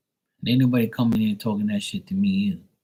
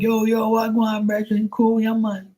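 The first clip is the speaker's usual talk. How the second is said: very high mock-Jamaican voice; Jamaican accent; 11 semitones higher than usual. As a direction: answer in a hammed-up, high-pitched Jamaican accent. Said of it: "I'm like".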